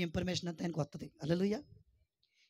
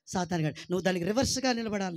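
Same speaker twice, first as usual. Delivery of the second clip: usual speaking voice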